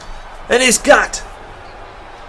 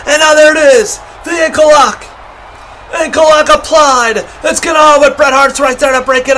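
excited play-by-play; 3 semitones higher than usual. Real, not acted. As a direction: call the finisher like it matters. Olympic Slam, near fall.

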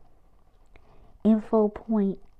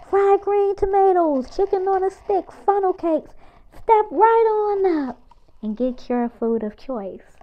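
higher, louder barker's call; fair food barker; 9 semitones higher, 7 dB louder than usual. Acted. Announcing food stalls to the crowd, higher and louder like a barker.

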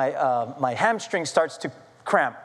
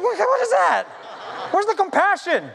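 mock outrage; 12 semitones higher than usual. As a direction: squawk in hammed-up disbelief at wife. Acted outrage at wife's remark.

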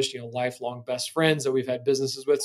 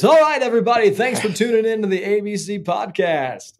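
loud, high, hyped radio DJ sign-off; cheesy DJ voice; 7 semitones higher, 8 dB louder than usual. Pretend. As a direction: ham up a cheesy radio host outro. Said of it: "I could do a cheesy D J voice".

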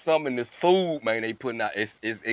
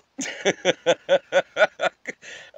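long loud cackle; real rapid-fire laughing; 4 semitones higher, 5 dB louder than usual. Real, not acted. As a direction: crack up at your own joke. Cracks up at his joke.

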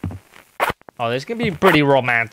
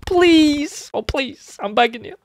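high frantic pleading; begging scream; 11 semitones higher, slower than usual. Pretend. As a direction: beg desperately, voice up high. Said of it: "She's begging".

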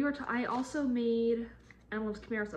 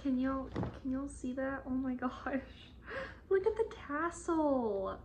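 high-pitched delight; thrilled, rising to a squeal; excited gushing; 5 semitones higher than usual. Real, not acted. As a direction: gush to viewers in a high voice. Showing viewers the cute tassel.

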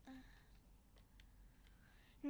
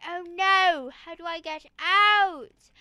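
loud, drawn-out dramatic wail; big theatrical cry for help; mock panic; 15 dB louder, slower than usual. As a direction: act out a trapped player's exaggerated dismay. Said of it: "And then you're like".

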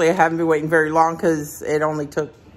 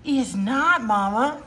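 usual speaking voice

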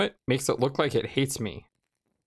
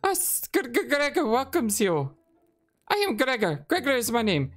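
high, stammering rat shopkeeper; 10 semitones higher than usual. Acted. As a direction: squeak it out like a nervous rat. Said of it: "rat voice".

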